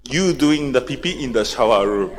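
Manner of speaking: low imitation of a father; imitating Father. Father repeating his accusation to the speaker, imitated low. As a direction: drop the voice to mimic the father. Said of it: "And again".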